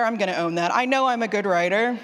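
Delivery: ordinary talk, nothing marked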